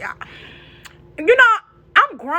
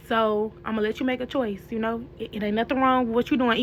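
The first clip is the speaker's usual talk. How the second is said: calm mom voice; 3 semitones lower, 7 dB quieter than usual. Retold or imitated. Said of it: "when your mama calm is, you know she real mad".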